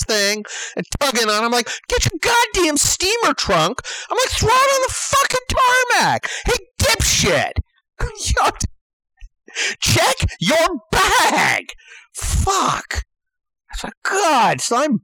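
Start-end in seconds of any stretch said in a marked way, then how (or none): 1.9-3.8 high-pitched exasperated command; retold angry outburst; irate order. Acted shouting at passenger. 4.3-13.0 high, strained exasperated yelling; hammed-up angry outburst; irate heckling. Acted shouting at passenger.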